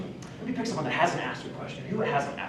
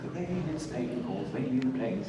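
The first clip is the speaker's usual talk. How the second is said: softly recited British elocution drill; British accent; 7 dB quieter than usual. Retold or imitated. Speech teacher drilling a pupil in diction, British accent. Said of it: "learned to speak from a British lady".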